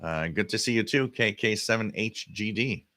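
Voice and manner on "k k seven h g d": ordinary talk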